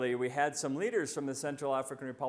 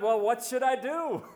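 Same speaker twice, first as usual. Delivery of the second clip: high, loud desperate shout; yelling up; 9 semitones higher, 5 dB louder than usual. Acted. Man on the cliff yells his question up to God, high and loud. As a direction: yell it upward in a panic. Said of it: "the man yells up to God".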